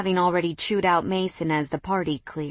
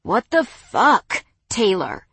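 angry snarl, louder; 4 semitones higher, 7 dB louder, slower than usual. Acted. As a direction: snap it out in shock and fury. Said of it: "heard a voice snarl".

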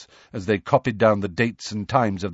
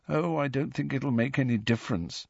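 soft, higher, downcast voice; miserable; 4 semitones higher, 7 dB quieter than usual. A narrator's reading. Neville answers Ron's encouragement, miserably. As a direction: give up quietly, sounding sorry for yourself. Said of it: "still more miserably".

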